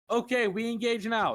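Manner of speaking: mock-Russian battle callout; Russian-sounding Wade. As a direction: imitate a broken-English Russian shot-caller. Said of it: "making Wade sound like he's Russian".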